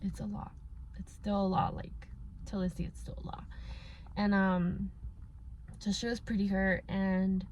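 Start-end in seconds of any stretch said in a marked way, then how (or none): 0.0-3.5 very soft, fading almost to a whisper; quietly overwhelmed. Real, not acted. Survivor admitting the family's hurt is still a lot, quietly overwhelmed. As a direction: let the voice sink under the weight.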